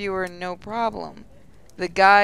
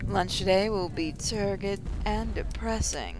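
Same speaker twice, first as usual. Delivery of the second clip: morning announcer. Acted. Mindy in the Morning addressing the students, announcer delivery. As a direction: stretch the openings like a PA host.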